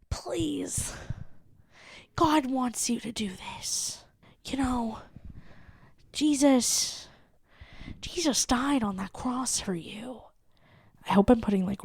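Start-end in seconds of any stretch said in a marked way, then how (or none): 0.1-10.2 breathy whispered pastor plea; impassioned pastor whisper. Retold or imitated. To listener, pastor pleads softly in a hushed whisper. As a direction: coax softly like a preacher in a hushed voice. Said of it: "in this impassioned voice".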